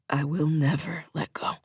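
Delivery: soft, unvoiced tender whisper; whispered vow. A narrator's reading. Answering her plea to hold on, whispered. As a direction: promise it in a hush.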